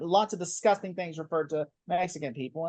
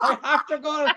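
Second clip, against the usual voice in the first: higher and louder mock-dramatic TV hero; hammed-up hero panic; 8 semitones higher, 5 dB louder than usual. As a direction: overplay a panicked sidekick urging escape. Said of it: "then it'd be like".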